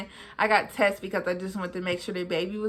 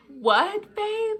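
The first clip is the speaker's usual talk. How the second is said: higher, mock-disappointed mom; 12 semitones higher than usual. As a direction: play the let-down mom. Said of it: "she tried to sound like she was disappointed".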